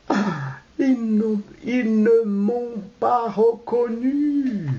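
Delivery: high, slow, drawn-out relief; stage aside. Acted. Disguised host sees he is unrecognised, stage aside. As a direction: draw out the sigh of an aside. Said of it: "Choufleury à part".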